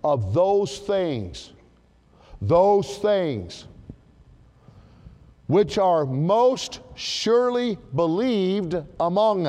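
"those things" drawn out slowly; slow emphasis on those things. Shown as a demonstration. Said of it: "now watch this phrase".

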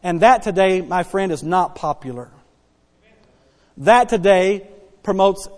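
ordinary talk

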